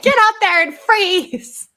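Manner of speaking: high, loud, drawn-out joke yell; mock shouted order. Mom mimics school staff sending kids out, mock shouted order. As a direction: yell it like a bossy teacher shooing kids out. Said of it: "They're like".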